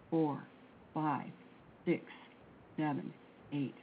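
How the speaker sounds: slow, soft counting through the breath; guided breath count. Acted. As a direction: lead the breathing calmly, one number at a time.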